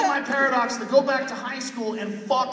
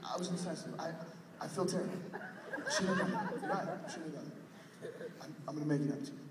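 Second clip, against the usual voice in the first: hushed, softer, low apology; 5 semitones lower, 12 dB quieter, faster than usual. Acted. Comic quietly coaxes the crying partner with a soft fake apology. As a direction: coax him quietly so he won't cry.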